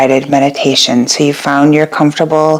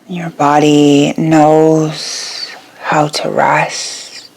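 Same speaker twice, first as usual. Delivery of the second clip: guided meditation. Acted. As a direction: guide them gently into rest, letting each phrase settle. Leads a yoga nidra meditation.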